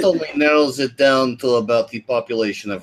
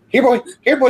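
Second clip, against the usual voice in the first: high-pitched dog-calling voice; 12 semitones higher, 4 dB louder, faster than usual. Acted. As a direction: coax them along like a puppy, high and excited. Coaxing someone along like a dog.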